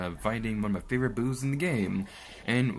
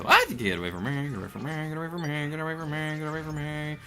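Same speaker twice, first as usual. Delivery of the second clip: higher pleading repeats; mock panic; 6 semitones higher, faster than usual. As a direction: beg it to go away. Fleeing enemy, repeating plea.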